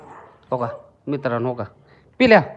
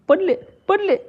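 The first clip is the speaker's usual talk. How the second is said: high, laughing sing-song; laughing coo; 12 semitones higher than usual. Real, not acted. Kitten tumbling over, high laughing coo. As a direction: giggle it out high as she tumbles.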